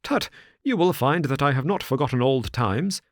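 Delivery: a hint of a hearty old squire calling out; old squire crying out. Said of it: "cried Mister Trevor".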